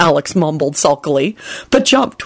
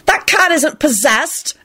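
raised pitch, slow indignant protest; angry; 9 semitones higher, slower than usual. A narrator's reading. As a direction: push back angrily, pitched up. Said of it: "Alex said angrily".

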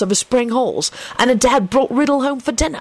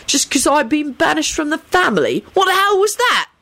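high-pitched indignant rant; outraged, high-voiced grievance; scornful; 12 semitones higher than usual. A narrator's reading. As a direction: complain with aggrieved, raised pitch. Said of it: "responded scornfully".

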